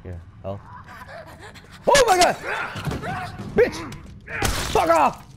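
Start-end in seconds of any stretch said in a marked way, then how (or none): 1.9-5.2 very high, loud screams; screaming in terror at the monster; terrified screaming. Real, not acted. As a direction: scream at the attacker in panic. Attacked by monster.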